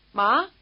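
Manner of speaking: Ma lifted like a question; rising intonation. Shown as a demonstration. Teacher asks for mother as in English, rising intonation. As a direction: ask Ma with the pitch climbing. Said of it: "with a rising intonation".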